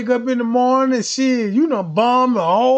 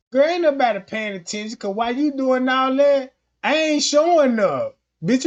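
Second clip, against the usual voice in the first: usual speaking voice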